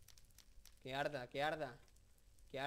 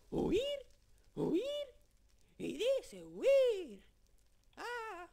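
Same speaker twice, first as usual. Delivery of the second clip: very high, loud, stretched-out creepy hiss of a voice; Gollum; 10 dB louder, much slower than usual. Retold or imitated. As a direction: drag it out, squealing higher and higher like a wretched creature. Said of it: "también sé imitar más o menos a Gollum". (imitación de Gollum, muy aguda, fuerte y arrastrada)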